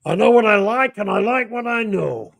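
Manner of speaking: high, hearty Yorkshire caller; hammed greeting. S0 playing a caller from North Yorkshire, high hearty voice. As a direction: boom a cheery hello in a high voice. Radio greeting, Philip Harling character.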